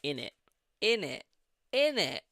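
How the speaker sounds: Birmingham accent. Shown as a demonstration. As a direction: punch out "innit" in Brummie. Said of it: "not Birmingham the way I say it".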